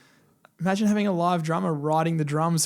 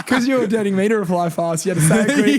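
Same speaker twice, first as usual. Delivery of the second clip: louder, higher teasing through laughs; laughing tease; 4 semitones higher, 7 dB louder than usual. Real, not acted. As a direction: rib him while laughing.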